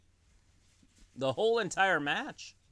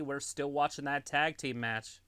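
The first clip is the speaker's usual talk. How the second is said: ordinary talk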